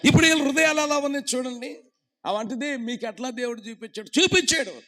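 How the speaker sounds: loud, high, fast preacher's call; preaching shout. Acted. Preacher urging the congregation to raise both hands, shouting.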